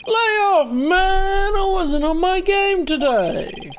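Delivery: high, loud protesting yell; whiny shout. Acted. Larry shouting back at Phoenix after getting rejected. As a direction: shout it back like a sulking loser.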